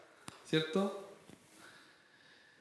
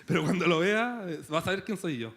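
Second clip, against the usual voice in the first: laughing menace; 4 dB louder than usual. Retold or imitated. Preacher mocking a grudge-holder, laughing. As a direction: repeat it louder through a laugh, half-joking threat. Repeat with laugh, vengeful punchline.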